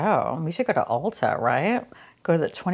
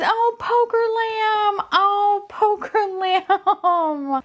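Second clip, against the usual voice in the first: high, drawn-out coo; cute-overload gushing; 12 semitones higher, much slower than usual. Real, not acted. Unboxer gushing over a toy lamb, high drawn-out coo. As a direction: coo slowly over something adorable.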